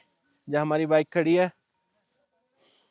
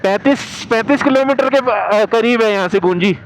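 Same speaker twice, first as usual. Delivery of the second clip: shouting over wind; 11 dB louder than usual. Real, not acted. Talking while riding the bike.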